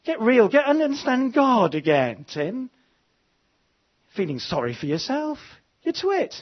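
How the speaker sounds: high, emphatic scolding; God's mock telling-off. God slapping the preacher about, mock scolding. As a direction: give a jolting, teasing reprimand. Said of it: "God slapped me about".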